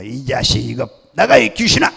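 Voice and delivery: cursing shout that leaps up at the end; loud imitation of a scolding pastor; thundering rebuke. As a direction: bellow it out to drive a spirit away. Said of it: "막 호통을 치는 거예요".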